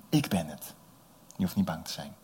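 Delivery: normal talking voice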